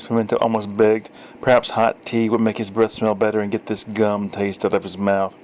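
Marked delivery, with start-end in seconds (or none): none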